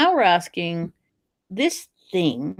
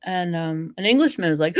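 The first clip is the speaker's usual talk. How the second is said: usual speaking voice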